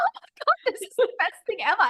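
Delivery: high, fast squeal of delight; excited squeal. Retold or imitated. To her friend on the call, a high excited squeal. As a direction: gush like you just heard the best news. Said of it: "and I was like".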